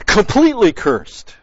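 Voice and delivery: COMPLETELY pushed high and loud; emphatic quote. Acted. Preacher quoting the intensified curse to the congregation, emphatic. Stressing the verse's intensified word.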